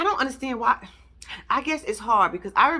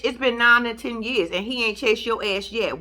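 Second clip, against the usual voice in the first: usual speaking voice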